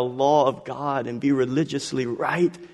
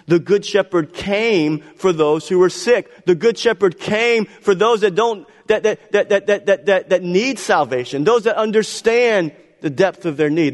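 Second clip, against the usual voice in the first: raised, insistent sermon voice; impassioned preaching; 6 semitones higher, 5 dB louder than usual. Acted. Preacher on whom the shepherd came for, impassioned. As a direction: press it home with punched key words.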